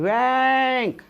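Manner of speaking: बैंक stretched on a long open 'ai'; drawn-out pronunciation. Shown as a demonstration. Teacher showing how it is pronounced, stretched demo. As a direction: hold the vowel of बैंक, then close on nk. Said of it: "ऐसी करके उच्चारण करते हैं".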